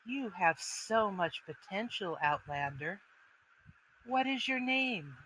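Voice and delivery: hushed, commanding elf woman; tall elvish woman, quietly. Acted. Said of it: "and she's going".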